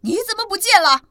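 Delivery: a frantic dad roaring; desperate dinosaur roar; roaring shout. Acted. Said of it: "霸王龙大声吼道".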